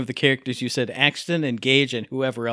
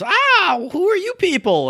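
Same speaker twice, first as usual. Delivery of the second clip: mock startled voice, much higher; imitating a celebrity; 5 dB louder, slower than usual. Fan imitating the Gearbox boss meeting them, startled. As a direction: squeak it like a surprised celebrity. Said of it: "he goes".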